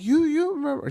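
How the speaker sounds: high-pitched mom voice; imitating mom. Mom asking her son if he remembers, in a high imitation. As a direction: squeak it out like his mother asking. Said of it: "She t-, tells me in Spanish".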